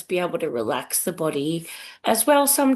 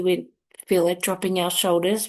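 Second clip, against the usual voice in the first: normal talking voice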